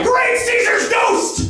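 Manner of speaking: high, slow bellowing exclamation. Acted. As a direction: bellow it like an old newspaper editor. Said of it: "raised his voice to cry".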